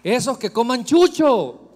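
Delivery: loud, scornful cry; scornful outburst. Real, not acted. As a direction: fling it out dismissively. Preacher dismisses critics.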